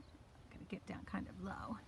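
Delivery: quiet whispered remark while crouching. Real, not acted. Teacher whispering to viewers while crouching by the kale.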